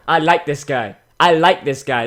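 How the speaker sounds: normal talking voice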